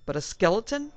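high, loud horrified cry; screaming. Acted. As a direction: shriek the question in disbelief. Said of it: "screamed his subconscious".

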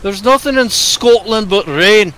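a mock Scottish accent, high and slow. Gamer teasing his co-op partner in a mock Scottish accent. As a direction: butcher a Scottish accent for laughs. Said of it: "sorry for butchering the Scottish accent".

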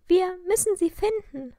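alarmed and insistent. A narrator's reading. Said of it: "sagte Lilly alarmiert".

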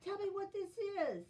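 ordinary delivery

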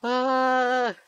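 high, drawn-out yelp of alarm; long high "ah" of panic; startled scream. Real, not acted. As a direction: cry out high in sudden fright. Startled by skeletons.

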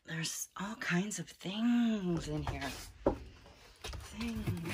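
slow, airy hushed whisper. Real, not acted. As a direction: whisper in awe of the clutter. Whispering while peeking in attic.

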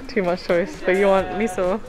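usual speaking voice

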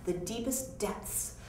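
ordinary delivery, nothing marked

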